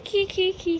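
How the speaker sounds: high little mock giggle "ખી ખી ખી"; giggle imitation. Shown as a demonstration. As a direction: show the child's tight giggle. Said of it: "બહુ જોરથી હસે ને, આમ અંદરથી ખુલી". (નાનું ખીખી હસવાનું અનુકરણ)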